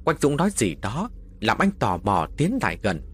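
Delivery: plain reading voice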